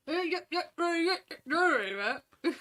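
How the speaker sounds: loud mock Yorkshire chatter; a hammed-up proper Yorkshire voice; proper Yorkshire accent. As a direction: rattle off yeahs like a broad Yorkshireman. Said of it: "proper Yorkshire's like".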